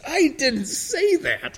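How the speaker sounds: high, slow, drawn-out mock protest; mock indignant protest. Misquoted pastor objects to the reporter, mock indignant.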